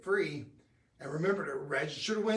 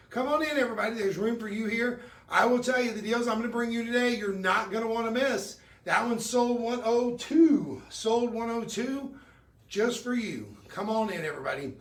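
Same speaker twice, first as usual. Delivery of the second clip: higher and louder, pushing the deal hard; hyped sales pitch; 6 semitones higher, 5 dB louder than usual. Acted. Shopping host hypes a ring to viewers, excited pitch. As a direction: sell it like the clock is running out.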